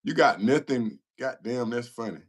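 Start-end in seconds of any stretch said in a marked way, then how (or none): none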